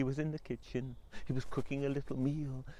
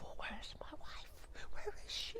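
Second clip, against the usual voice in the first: whispered thoughts; 12 semitones higher, 8 dB quieter than usual. Acted. Husband whispering his thoughts about his wife. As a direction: breathe the worry under your breath. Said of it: "all he could think about".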